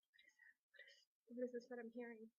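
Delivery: near-whispered, halting question; as if listening out for strange noises; hushed alarm. Retold or imitated. Acts out hearing noises at home.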